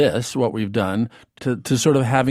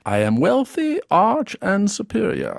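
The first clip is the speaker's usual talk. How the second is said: higher, superior British accent; 7 semitones higher than usual. Shown as a demonstration. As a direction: sound wealthy and arch in British English. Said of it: "So let's try this".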